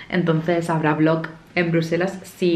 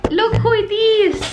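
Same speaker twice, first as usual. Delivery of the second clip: much higher, slow cooing voice; 4 dB louder, slower than usual. Real, not acted. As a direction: coo at the puppy slowly. Calling the dog over.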